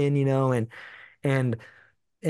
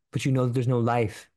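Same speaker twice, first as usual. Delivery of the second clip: normal talking voice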